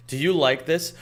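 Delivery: ordinary talk, nothing marked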